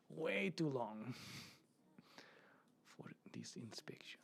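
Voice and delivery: slow drawn-out line sinking to a whisper; hammed-up doctor drawl. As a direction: stretch it out then fade to a hush. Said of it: "like acting".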